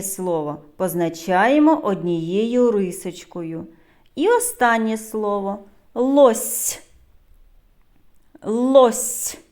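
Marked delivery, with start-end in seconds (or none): 5.9-9.4 "Лось" with a long soft final "с"; drawn-out soft S. Shown as a demonstration. Teacher showing the last sound, "Лось" ending in long soft "сь". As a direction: hold the palatal hiss ending "Лось". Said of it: "Де ми чуємо звук с".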